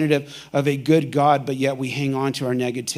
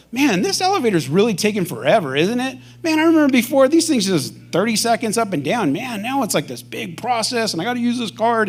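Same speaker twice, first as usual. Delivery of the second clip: whiny complainer; 6 semitones higher than usual. Acted. Acted complainer in elevator.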